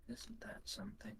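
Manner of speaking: high, breathy unvoiced whisper; eerie whisper. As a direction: hush it out like a secret. Player speaks after hearing the cackling.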